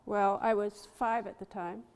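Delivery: normal talking voice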